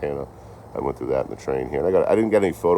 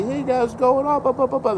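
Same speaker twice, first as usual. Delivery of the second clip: much higher, sing-song mock cashier chirp; mimicking a bubbly clerk greeting other customers; chatty cashier impression. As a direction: lampoon the chatty Cathy, pitched way up. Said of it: "she was a chatty Cathy".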